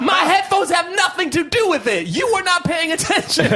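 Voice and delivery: very high outraged shout; high, exasperated reenacted yell; retold screaming. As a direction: yell it in hammed-up indignation, voice way up. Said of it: "so many screaming".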